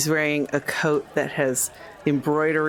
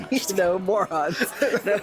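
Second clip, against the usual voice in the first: quoting a film through laughter; laughing; 3 semitones higher than usual. Real, not acted. Player echoes a Blazing Saddles line to friends, laughing. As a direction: giggly echo of a movie line.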